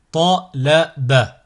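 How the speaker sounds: slow, each syllable drawn out separately; split into syllables. Shown as a demonstration. Teacher models a word for learners to repeat, split into syllables. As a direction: cut the word up, pausing between syllables. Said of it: "décomposer le mot".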